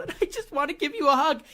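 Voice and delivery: high mock-sobbing gush, pitched well up. Mocking [name] writing a tearful note to [name], in a high, teary, gushing voice. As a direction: ham up a teary celebrity fan note. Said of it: "she's crying and she's writing".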